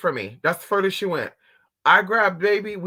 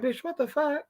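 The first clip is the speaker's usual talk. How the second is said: high, voiced for the dog, sassy; mock dog voice; voicing the dog; 10 semitones higher, 5 dB quieter than usual. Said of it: "she's like".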